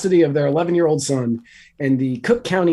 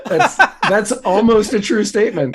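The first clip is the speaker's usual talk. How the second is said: laughing; 7 semitones higher, 4 dB louder than usual. Real, not acted. Laughing reply.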